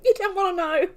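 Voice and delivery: high words fading into breathless laughter; helpless laughter. Real, not acted. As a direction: laugh until the voice gives out. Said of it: "if you're wondering why I'm laughing".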